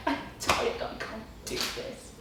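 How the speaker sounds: weary whisper, giving up; tired whispered moan; whispered complaint. Retold or imitated. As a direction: breathe it out, exhausted and defeated. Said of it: "And I was like".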